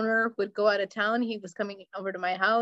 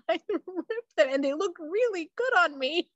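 laughing lament; 8 semitones higher than usual. Real, not acted. Laughing about expensive pants.

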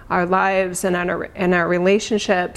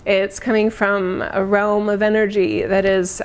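ordinary talk, nothing marked

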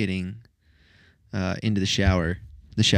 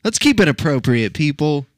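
mock stern; 5 semitones higher, 7 dB louder than usual. Mock scolding the audience.

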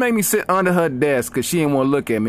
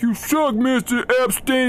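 put-on much higher taunting kid; bratty kid voice; 11 semitones higher than usual. Students on Zoom taunting the chemistry teacher, high bratty jeer. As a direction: heckle like a bored student. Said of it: "And they were like".

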